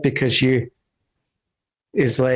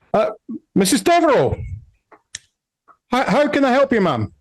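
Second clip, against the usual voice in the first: high-pitched, flustered politeness; sheepish, high-voiced deference; nervous guard; 11 semitones higher, faster than usual. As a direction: stammer respectfully to a feared matron. Guard nervously answers stern old woman.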